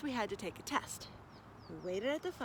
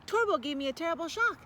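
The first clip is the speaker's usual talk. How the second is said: usual speaking voice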